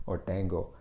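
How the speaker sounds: "tango" read letter by letter as a Tamil speaker would; a second transliterated variant of "tango"; Tamil-style pronunciation. Shown as a demonstration. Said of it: "the Tamil spelling of tango".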